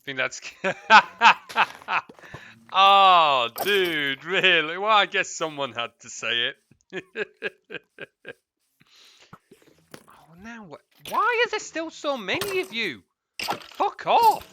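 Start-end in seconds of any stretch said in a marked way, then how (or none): 0.5-2.5 loud burst of real laughter. Real, not acted. Laughing hard at a viewer's chat message, loud. 11.1-14.5 high, loud exasperated yell. Real, not acted. Swarmed by mobs in the game, yelling high and loud.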